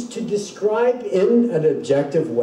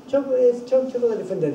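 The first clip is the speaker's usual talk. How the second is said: higher, hesitant, offering it up for approval; unsure guess, testing the room; tentative; 7 semitones higher, faster than usual. Shown as a demonstration. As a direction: float it as a question to the others. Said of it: "even you can hear in the tone of voice".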